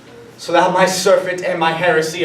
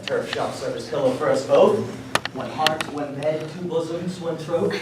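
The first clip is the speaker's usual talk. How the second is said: tender; 6 dB quieter than usual. Acted. Lovers lying down together.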